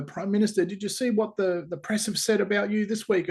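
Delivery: high-pitched flustered outburst, voice jumping up; irate secretary. Retold or imitated. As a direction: rush in anxious and irate, pitch way up. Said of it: "getting irate".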